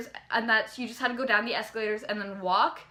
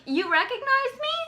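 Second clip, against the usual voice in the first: much higher disbelieving question; amazed question; 12 semitones higher, 3 dB louder than usual. Retold or imitated. Fan asking her idol, high amazed question. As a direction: gasp it out in disbelief.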